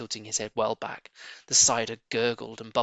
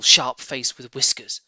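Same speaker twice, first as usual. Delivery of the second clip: ordinary delivery, nothing marked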